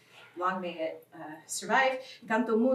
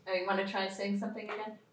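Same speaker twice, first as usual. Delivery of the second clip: ordinary talk, nothing marked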